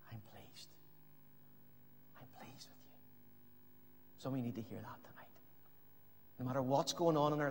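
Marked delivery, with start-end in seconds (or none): 0.1-3.0 tender whisper. Acted. God's voice spoken tenderly. 4.2-5.3 quick whispered aside; hushed whisper. Real, not acted.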